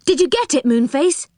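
high-pitched expectant question; eager cry. Acted. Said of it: "they cried".

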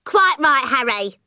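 high, shrieky wife's agreement; indignant mother. Acted. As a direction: back your husband up, high and sharp. Mother backs the angry father.

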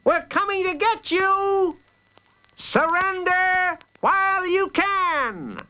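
very high, drawn-out challenge; calling out. A narrator's reading. Shapiro demanding the Fang's surrender by megaphone, calling out. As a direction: call it high across the water, holding the last word. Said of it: "she called out".

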